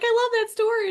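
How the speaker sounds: mock your own gushing. Host mocking her own gushing at the story, high squeal. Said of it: "here I am over here just like".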